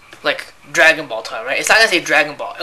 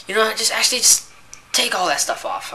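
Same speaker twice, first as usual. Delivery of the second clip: mimicking the customer; 7 semitones higher than usual. Customer quoted, voice raised.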